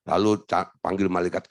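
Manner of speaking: normal talking voice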